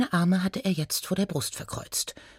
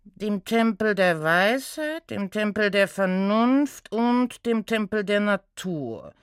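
lightly voiced slow, droning briefing; reluctant centaur; 3 semitones higher than usual. Inspector briefing his intern, reluctant drone. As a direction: deliver the case with weary patience.

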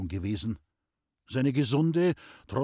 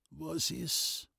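soft, higher, feeble reply; weak voice; 5 semitones higher, 7 dB quieter than usual. A narrator's reading. Sick man answering from his bed, weak voice. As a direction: answer faintly, as if ill. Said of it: "klang mit schwacher Stimme die Antwort".

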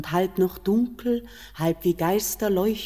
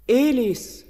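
a high, loud summons by name; commanding call; 5 semitones higher, 6 dB louder than usual. Acted. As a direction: call him to attention. Queen calls his name commandingly.

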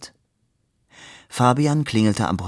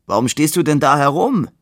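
loud, higher, annoyed yell; angry shout; 6 semitones higher, 5 dB louder than usual. A narrator's reading. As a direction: shout it across the street. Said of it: "schrie Labude und kam ärgerlich".

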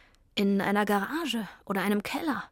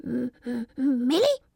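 squirrel frozen with fear, stammering; 2 semitones higher than usual. Acted. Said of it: "stammelte es".

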